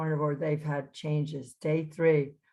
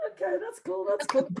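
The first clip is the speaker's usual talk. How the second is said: breezy mock cool; 9 semitones higher than usual. Winded teacher answering kids.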